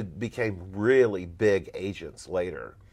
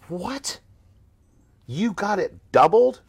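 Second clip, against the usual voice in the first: high, loud disbelief; surprised client; 9 semitones higher, 7 dB louder than usual. Retold or imitated. Client on the phone hearing the doubled fee, loud and amazed. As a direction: act shocked at the doubled offer.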